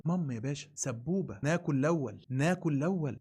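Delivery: mock them as caring only to eat first; mock greedy priests. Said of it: "فالموضوع بالنسبه لهم".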